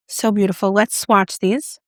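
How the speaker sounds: usual speaking voice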